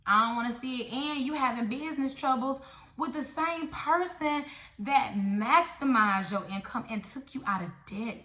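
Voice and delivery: ordinary delivery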